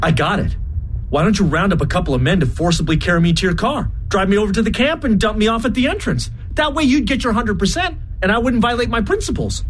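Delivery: 越说越快越高，兴奋地献计; 灵机一动，急切地说出主意; eager idea. Acted. Gordon's sudden bright idea.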